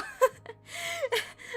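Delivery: squeaky giggle; high giggle. Real, not acted. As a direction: laugh it off. Laughs after failed accent.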